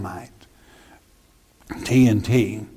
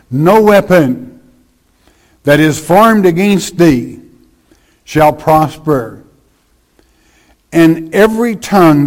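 scripture reading; 5 dB louder than usual. Acted. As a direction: proclaim the verse from the pulpit. Reads scripture aloud to congregation.

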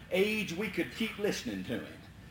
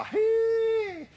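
huge high call to the dogs; holler; 4 dB louder than usual. Retold or imitated. Hunter calling his dogs loudly. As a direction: whoop out across the swamp.

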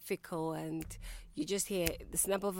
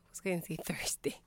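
whispered through a giggle; laughing. Real, not acted. Giggling at own mistake.

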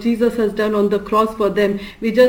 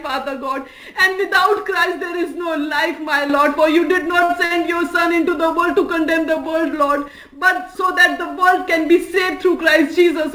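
higher-pitched, urgent prayer voice; praying aloud in a raised pitch, phrase after phrase; fervent prayer; 7 semitones higher than usual. Real, not acted. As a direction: pour out a continuous plea to God.

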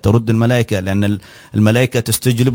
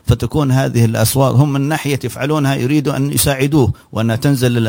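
ordinary talk, nothing marked

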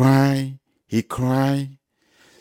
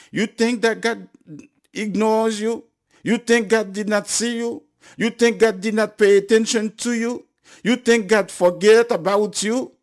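higher-pitched rhetorical challenge; pleading, raised-pitch pulpit questions; impassioned preaching; 8 semitones higher than usual. Acted. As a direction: press each question on the listeners.

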